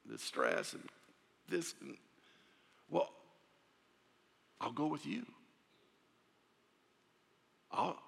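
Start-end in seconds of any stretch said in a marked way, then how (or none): none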